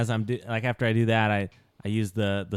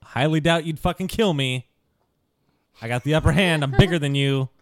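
higher, harder bullying threat; angry threat; 6 semitones higher, 4 dB louder than usual. Acted. Thaddeus threatens the rescued elf, angry and higher. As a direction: puff yourself up and menace him.

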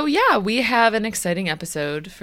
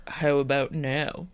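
low, slow founder impression; Elizabeth Holmes; 4 semitones lower, slower than usual. Host retrying her pitch in a deep, slow put-on voice. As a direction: drop it deep and draw it out. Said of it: "Try to convince me of something".

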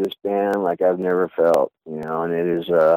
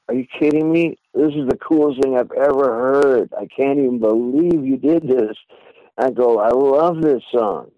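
higher, excited praise; retold delight; 6 semitones higher than usual. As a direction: gush over the song with joy. Retold delight hearing finished song.

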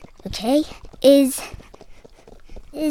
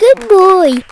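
dog praise voice; 6 semitones higher, 7 dB louder than usual. Real, not acted.

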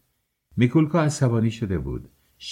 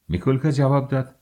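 ordinary delivery, nothing marked

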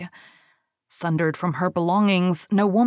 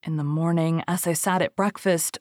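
ordinary delivery, nothing marked

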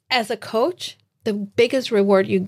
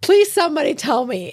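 higher-pitched plea for help; mock plea; 6 semitones higher than usual. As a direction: act out begging someone for answers, pitched up. Acts out past helpless plea.

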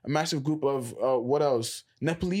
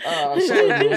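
laughing; 9 dB louder than usual. Real, not acted. Laughing at own impression.

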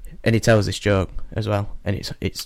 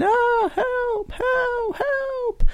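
very high, falsetto cries for help from a burning window; screaming woman; much slower than usual. Acted. Woman in a burning building shrieks for help to the keeper below. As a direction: squeal it like a panicked mother trapped upstairs. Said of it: "a woman in the window screaming, going".

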